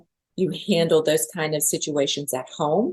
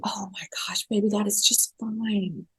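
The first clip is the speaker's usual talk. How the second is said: hushed mother; 4 semitones higher, 5 dB quieter than usual. Retold or imitated. Mother's reply voiced in story.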